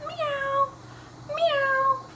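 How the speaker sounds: high, drawn-out mewing; cat meow. Acted. Storyteller lets children hear Cat's voice, high meow. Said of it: "it sounded like this".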